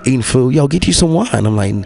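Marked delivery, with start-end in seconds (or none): 0.5-1.3 mock pushy relative. Family pushing wine retold.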